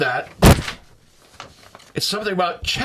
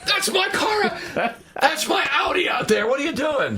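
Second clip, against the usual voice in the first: shocked shouting car owner; 11 semitones higher than usual. Pretend. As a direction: shout it like your car is wrecked. Acted car owner seeing his car.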